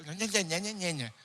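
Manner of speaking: immature nya-nya grumble; childish mocking. Churchgoer being rude back out of immaturity, childish mocking. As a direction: mimic a petty, bratty mutter. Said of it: "La inmadurez de uno".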